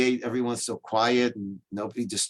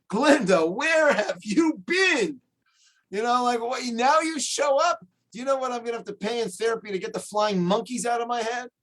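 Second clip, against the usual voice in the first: much higher, exasperated complaint at Glinda; indignant child Dorothy; 10 semitones higher than usual. Retold or imitated. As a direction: scold her like a wronged kid, pitched way up. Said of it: "really angry at Glinda".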